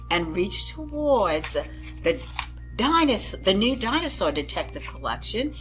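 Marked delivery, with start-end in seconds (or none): none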